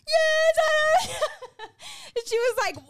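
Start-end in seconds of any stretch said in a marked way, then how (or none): 0.1-1.2 high and loud, laughing through it; laughing admission. Retold or imitated. Telling her best friend she's pregnant, a loud, high laughing yes. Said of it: "I was like". 1.2-2.1 high squealing laugh. Real, not acted. Laughing after telling friend.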